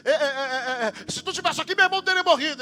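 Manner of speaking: a hint of hurried, stammering Martha; Martha's complaint. Preacher voicing Martha reproaching Jesus, mildly. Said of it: "ela diz assim".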